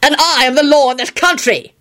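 high-pitched furious retort; angry shout. A narrator's reading. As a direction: lose your temper, voice rising high. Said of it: "he shouted".

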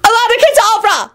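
very high, frantic cry; high-pitched desperate screech; panicked shout. A narrator's reading. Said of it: "Elizabeth shouted".

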